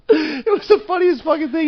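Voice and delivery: squeezed out high through laughter; real laughing. Real, not acted. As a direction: barely get it out while cracking up. Cracking up at co-host's impression.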